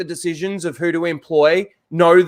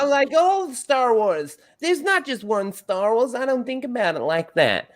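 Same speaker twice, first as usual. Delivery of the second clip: mocking impression; 6 semitones higher, 3 dB quieter than usual. Mocking her dodging the favorite Star Wars question, higher. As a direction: imitate her evasive answer. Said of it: "that question about favorite movie".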